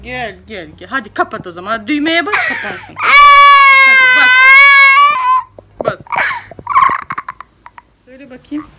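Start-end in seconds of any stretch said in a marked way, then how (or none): 1.7-6.0 laughing, much higher and louder, urging her on; excited urging. Real, not acted. Mother laughing and urging her daughter to press the button. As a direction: squeal it, egging her to press.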